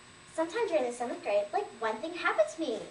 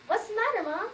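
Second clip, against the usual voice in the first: put-on higher-pitched, anxious child's question; alarmed question; 6 semitones higher, 4 dB louder than usual. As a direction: ask Mom urgently. Child questions screaming mother.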